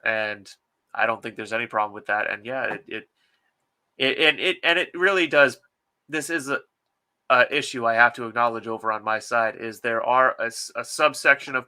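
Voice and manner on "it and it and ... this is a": normal talking voice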